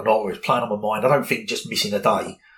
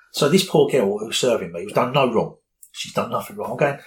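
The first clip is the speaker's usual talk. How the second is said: ordinary delivery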